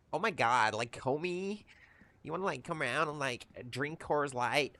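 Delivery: hammed-up Californian stoner invite; mock California accent. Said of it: "it is a rough accent".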